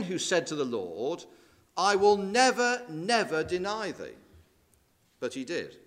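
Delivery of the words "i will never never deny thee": NEVER, NEVER pitched high and loud; a vow stressed on the two NEVER; emphatic never never. Retold or imitated. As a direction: swear it with a raised NEVER. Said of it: "emphatic negative".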